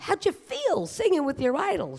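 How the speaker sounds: high, gushing interviewer voice; squealing TV host asking the star a question; mock interviewer. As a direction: play a breathless fan reporter. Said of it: "they go up to her".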